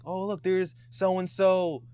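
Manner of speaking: slow, high hype-man call; imitating his own mic announcing; announcer voice. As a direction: boom it out like a rally MC. Hosting pep rally on mic.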